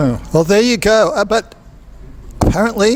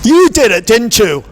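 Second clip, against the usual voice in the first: loud, raised accusation; accusing; 2 semitones higher, 4 dB louder than usual. Acted. Inspector confronting a suspect, loudly accusing. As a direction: pounce on the suspect with a pointed charge.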